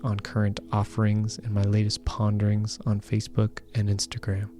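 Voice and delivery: calm, even announcer read; podcast outro read. Acted. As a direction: read the outro warmly and evenly. Podcast outro read over music.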